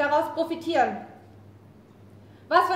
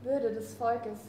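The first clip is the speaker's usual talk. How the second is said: much softer, lower murmured echo; 5 semitones lower, 8 dB quieter, slower than usual. Acted. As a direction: repeat it to herself. She echoes his words softly.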